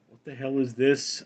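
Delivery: ordinary talk, nothing marked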